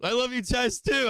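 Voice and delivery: high, bright burst of affection; gushing thanks. Real, not acted. Trader thanking viewers, high and gushing. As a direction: beam it at the chat. Thanking chat after big win.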